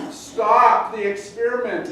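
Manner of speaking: loud, high, drawn-out cry to stop; acted pleading shout, slow and high; imitated yell. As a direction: yell out like a protesting test subject. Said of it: "would yell and scream".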